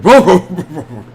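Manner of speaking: burbled imitation of Welsh speech, starting loud and dropping away; mock unintelligible Welsh. Streamer joking about understanding Welsh people, mock Welsh babble. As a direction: babble like incomprehensible Welsh talk. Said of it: "You can't really understand Welsh people anyway".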